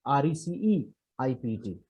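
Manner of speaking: R E C E I P T said slowly letter by letter; spelled out. Shown as a demonstration. Teacher spelling receipt for the class, slowly. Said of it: "spelled letters".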